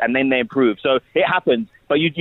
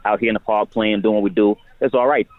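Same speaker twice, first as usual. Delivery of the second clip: casual American accent from New York. Shown as a demonstration. Actor showing a host his accents, easy New York accent. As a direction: talk like a laid-back New Yorker. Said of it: "if I'm from the U S".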